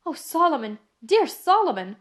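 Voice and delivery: overjoyed cry at finding her lost brother; joyful cry. A narrator's reading. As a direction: call out his name in shocked delight. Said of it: "cried Miss Pross".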